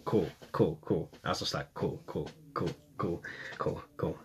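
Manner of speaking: soft, fading repeated cool; trailing into a nervous under-the-breath mutter. Retold or imitated. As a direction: mumble to calm yourself down. Nervous self-calming.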